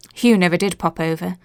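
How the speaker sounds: ordinary delivery, nothing marked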